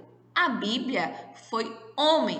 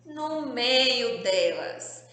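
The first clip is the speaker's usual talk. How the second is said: No meio delas stretched slowly; teacher stressing the answer slowly; slow emphatic answer; much slower than usual. Shown as a demonstration. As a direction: spell it out slowly for the class. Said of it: "o S tá onde".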